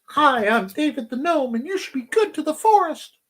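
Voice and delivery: squeaky little gnome greeting the forest; David the Gnome. Pretend. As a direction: chirp it like a tiny cartoon gnome. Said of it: "this voice that I really like".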